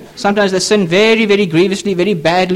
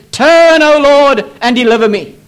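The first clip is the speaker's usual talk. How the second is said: loud, high opening call that eases off; imploring cry; 4 semitones higher, 4 dB louder than usual. Retold or imitated. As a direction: call out to God as the psalmist. Psalmist's cry to God read out.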